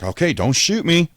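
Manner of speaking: high, loud frantic plea; begging the cops not to shoot; pleading surrender. Retold or imitated. Hands out to police, a frantic high plea.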